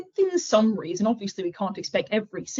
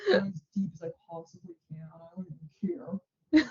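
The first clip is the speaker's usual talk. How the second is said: deepest register. Shown as a demonstration. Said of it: "my deepest register".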